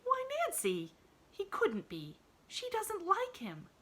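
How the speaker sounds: high surprised objection; objecting. Acted. Girl objects to the maid, high and disbelieving. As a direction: protest in disbelief. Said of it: "objected Pollyanna".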